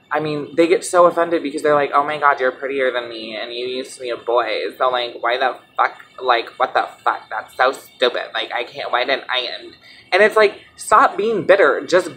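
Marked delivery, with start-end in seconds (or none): 1.9-9.8 low, soft mocking imitation; mock jealous grumbling. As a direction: imitate a sulky complainer, voice dropped. Mocks jealous critics' complaint.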